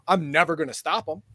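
usual speaking voice

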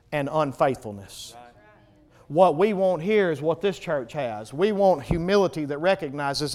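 normal talking voice

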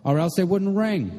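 high-pitched reply called upstairs; calling back. A narrator's reading. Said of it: "Jean called back".

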